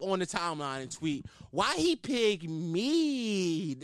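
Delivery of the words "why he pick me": slow, drawn-out mocking impression; mimicking a grown man's drawn-out complaint. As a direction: drag out the last word like a wounded complaint. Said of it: "that's how I read it".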